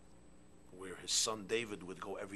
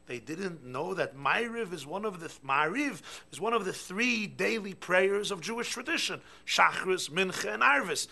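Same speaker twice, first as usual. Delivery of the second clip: loud, high impassioned sermon voice; full-force pulpit delivery; fiery preaching; 7 semitones higher, 8 dB louder than usual. Acted. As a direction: preach it hard, rising in outrage.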